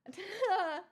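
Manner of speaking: high, loud burst of laughter. Real, not acted. Streamer laughing at a gifter's commitment, loud and high. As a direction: laugh out in delight. Delighted at the gift.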